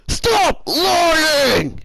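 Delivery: very high, loud screamed order; imitated scream. Fourth grade teacher accusing a student of lying, imitated scream. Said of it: "she screamed".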